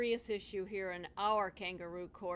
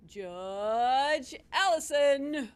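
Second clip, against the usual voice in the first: slow, loud courtroom announcement; drawn-out announcement; 3 semitones higher, 8 dB louder, much slower than usual. Acted. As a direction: announce the judge grandly. Bailiff announces the judge.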